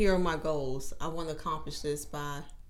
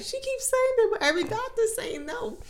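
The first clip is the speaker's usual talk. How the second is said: tearful; 3 dB louder than usual. Retold or imitated. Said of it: "and I'm crying".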